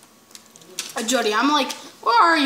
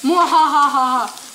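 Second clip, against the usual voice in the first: mad scientist; 7 dB louder, slower than usual. Pretend. Scary guy, evil laugh.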